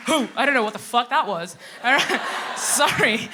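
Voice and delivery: ordinary talk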